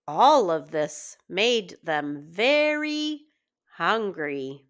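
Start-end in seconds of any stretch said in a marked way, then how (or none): none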